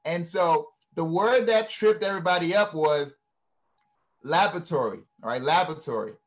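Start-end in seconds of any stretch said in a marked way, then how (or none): 4.2-5.0 "laboratory" with LAB stressed and the first "o" dropped; American pronunciation. Shown as a demonstration. Telling listeners the word that tripped everyone up, American pronunciation. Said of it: "the kids say laboratory". 5.4-6.1 "Laboratory" as LAB-ruh-tory, stress up front; American pronunciation. Shown as a demonstration. Repeating the tricky spelling word, American pronunciation. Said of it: "the kids say laboratory".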